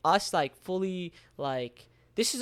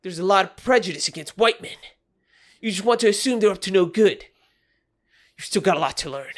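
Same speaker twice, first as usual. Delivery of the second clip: raspy voice; 5 dB louder than usual. Acted. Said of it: "I had to do a raspy".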